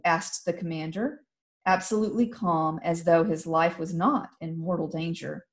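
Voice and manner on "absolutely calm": ordinary delivery, nothing marked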